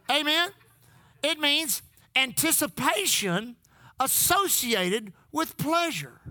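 usual speaking voice

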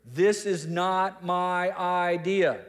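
slow, drawn-out "This is not my idea"; very clear. Acted. Peter making sure the gathered crowd hears, very clear, emphatic. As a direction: spell out "not my idea" slowly and firmly. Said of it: "he wants to make it very, very clear".

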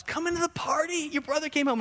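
high-pitched happy call; mock jubilant; cheerful invitation. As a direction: play a father inviting his son in. Father invites older son, acted.

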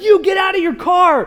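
high, shouted order; commanding shout. Retold or imitated. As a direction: bark it at the truck driver. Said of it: "and I'm like".

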